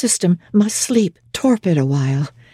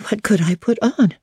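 ordinary delivery, nothing marked

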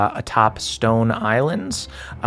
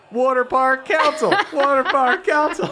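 high muttered chant; grumbling crowd; 13 semitones higher than usual. Acted. Crowd grumbles over the idea in high chant. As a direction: mull it over aloud in a high voice. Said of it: "they go".

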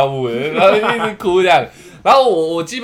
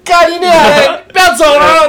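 high, loud mock cursing yell; mock angry shout; 9 semitones higher, 8 dB louder than usual. Drunk friend cursing the strangers, mock angry shout. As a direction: curse at them like a raging drunk. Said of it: "看到对面人".

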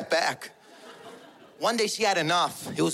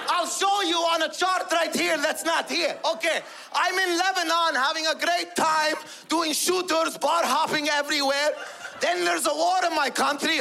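put-on high-pitched accented dad rant; father's pent-up outburst; 6 semitones higher than usual. Immigrant father finally asked how he feels, worked-up rant. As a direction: let it all out in a high, worked-up voice.

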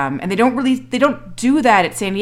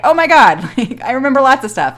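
louder, higher gushing; louder excited gushing; eager; 6 semitones higher, 5 dB louder than usual. Retold or imitated. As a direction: play her delighted to remember. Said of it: "have Catherine be like".

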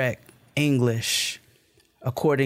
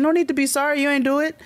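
high reassuring reply; 12 semitones higher, faster than usual. Retold or imitated. Reassuring the tailor.

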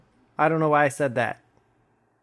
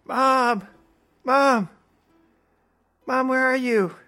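calling for mom; 9 semitones higher, slower than usual. Acted. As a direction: shout for mom from the bedroom. Player calls out for mom.

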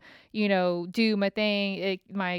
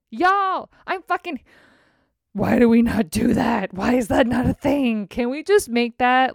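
high, loud excited pitch; excited outburst; 3 semitones higher, 5 dB louder than usual. Real, not acted. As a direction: burst out with the idea, much louder. Excited realization after story.

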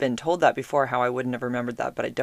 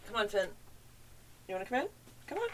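higher, soft coaxing to a pet; coaxing a dog; 8 semitones higher, 14 dB quieter, much faster than usual. Real, not acted. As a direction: sweetly call the dog inside. Calling her dog in.

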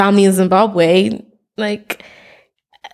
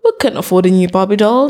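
usual speaking voice

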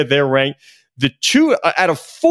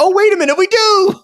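much higher, hammed-up sudden realization; pitched way up, mock surprise punchline; 14 semitones higher, 3 dB louder than usual. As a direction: act out a commissioner slapping his forehead, jokingly. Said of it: "George Kliavkoff is going".

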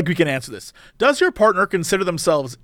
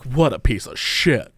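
1 snide aside; 8 semitones lower, slower than usual. Retold or imitated. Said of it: "L A people smile at you and be like".

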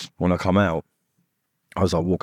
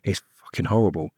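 ordinary talk

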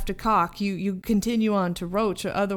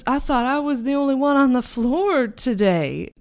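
a touch higher and louder, surprised creature; a small creature caught off guard, mildly raised; startled creature; 5 semitones higher, 6 dB louder than usual. Acted. GM voices the cockroach, startled.

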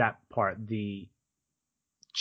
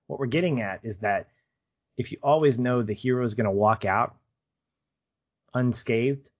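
usual speaking voice

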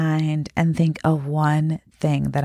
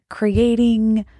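ordinary talk

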